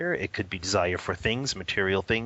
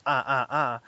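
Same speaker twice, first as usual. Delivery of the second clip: put-on patient baby sounds; 4 semitones higher, 4 dB louder than usual. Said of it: "shakes his head patiently and goes".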